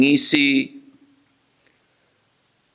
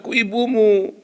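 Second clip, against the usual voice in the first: lightly voiced mother; 11 semitones higher than usual. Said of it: "Wajah-wajah ibunya".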